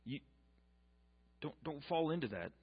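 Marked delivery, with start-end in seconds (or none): none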